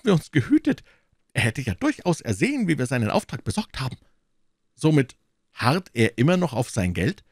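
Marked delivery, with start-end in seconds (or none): 0.0-4.0 high, fretful innkeeper voice, wringing his hands; anxious innkeeper. Acted. Innkeeper pleading with D'Artagnan, high and anxious. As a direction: play the worried host complaining.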